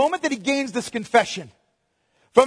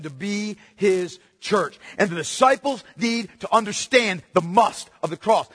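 usual speaking voice

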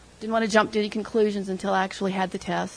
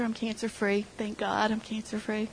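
usual speaking voice

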